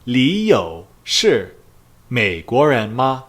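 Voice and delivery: slow Mandarin, "Li" stressed, "ma" rising; drawn-out "Li You shi Meiguoren ma?"; slow model question. Shown as a demonstration. Teacher models the question slowly for students. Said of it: "the question would be".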